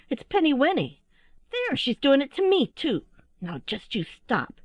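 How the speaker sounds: high, indignant youngster, dropping at the end; squabbling little owl. A narrator's reading. Owlet blaming its sister, high protest. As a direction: tattle in a high child voice. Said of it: "said another voice".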